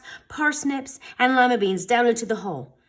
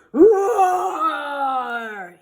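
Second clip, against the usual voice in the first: loud, higher monster roar; 6 semitones higher, 5 dB louder than usual. A narrator's reading. The monster at Chester, a loud roar. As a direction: roar it out as a beast. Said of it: "it roared".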